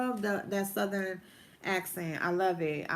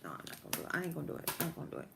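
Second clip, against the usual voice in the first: very soft, near-whispered self-talk; hushed, private aside under the breath; talking to oneself; 5 semitones lower, 12 dB quieter, much faster than usual. Real, not acted. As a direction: mutter a decision to yourself. Deciding to self about pink.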